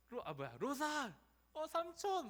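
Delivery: high, loud cries of recognition; excited reunion. Acted. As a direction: call out as if finding lost family. Acted joyful reunion of kin. (반갑게 외치듯)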